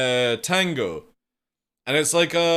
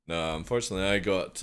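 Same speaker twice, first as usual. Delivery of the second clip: usual speaking voice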